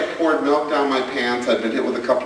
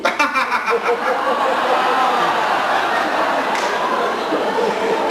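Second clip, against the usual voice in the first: long high laugh; 9 semitones higher than usual. Real, not acted. Laugh at the punchline.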